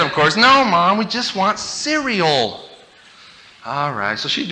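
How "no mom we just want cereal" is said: put-on kids pleading. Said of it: "what we said".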